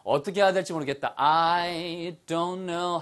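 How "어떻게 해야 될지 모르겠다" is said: ordinary talk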